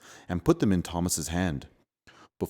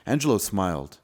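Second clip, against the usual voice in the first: usual speaking voice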